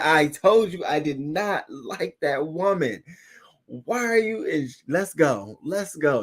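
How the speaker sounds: high-pitched mock scolding, hurrying him out; scolding lady at Red Lobster. Angry woman ordering the man out of the restaurant, mock scolding. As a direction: play the fed-up woman dragging him away. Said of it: "and she said".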